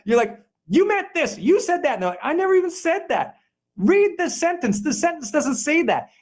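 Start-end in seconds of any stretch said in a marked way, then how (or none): 0.7-1.9 high-pitched mock indignant accuser; mock accusation. Upset email reader accusing the writer, mock indignant. 2.2-6.1 high-pitched, exasperated mock denial; mock protest. Email writer defending against false accusation, mock indignant.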